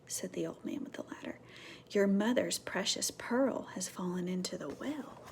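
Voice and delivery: soft whispered telling; hushed storyteller. A narrator's reading. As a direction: hush it like a secret. Whispered narration.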